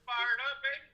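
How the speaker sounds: high hyped cheer, whooping; cheerleader. Real, not acted. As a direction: cheer it like a pep rally. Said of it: "You sound like a cheerleader now".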